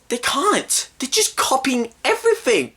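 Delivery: very high-pitched indignant complaint; exasperated rant. Real, not acted. Rants about the copying.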